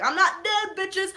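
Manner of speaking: high, loud triumphant reveal; gloating Loki quote. Retold or imitated. Loki taunting the theater, triumphant. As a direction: crow it like a villain back from the dead. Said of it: "he's like".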